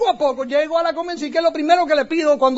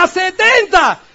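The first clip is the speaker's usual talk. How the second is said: louder, higher called-back reply; shouted answer; 6 semitones higher, 7 dB louder, slower than usual. Retold or imitated. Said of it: "Él me grita".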